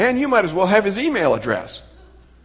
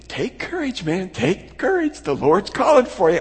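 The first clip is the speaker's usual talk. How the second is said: high-pitched mock cheering-on; mock encouragement; 6 semitones higher than usual. Fickle crowd now encouraging Bartimaeus, mock encouragement. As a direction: imitate a crowd suddenly turned supportive, pitched up. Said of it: "then they say".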